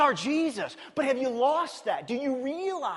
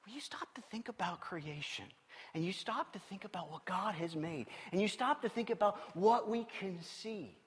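whispered, much softer; hushed whisper; 4 semitones lower, 11 dB quieter than usual. Real, not acted. Pastor whispering to the congregation about creation. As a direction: drop to a hushed whisper to draw them in. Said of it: "hushed reflective moment".

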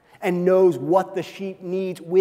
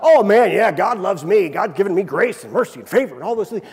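loud, fast mock cheer; hammed-up excitement; mock excited; 6 dB louder than usual. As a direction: play the happy believer, loud. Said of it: "if everything goes well".

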